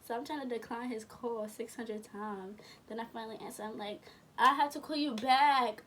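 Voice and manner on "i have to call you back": loud, insistent retort into the phone; exasperated. Retold or imitated. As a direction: raise your voice, fed up with the caller. Exasperated at repeated phone calls.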